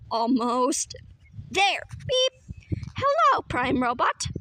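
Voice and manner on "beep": robotic beep. Acted. Machine sound effect.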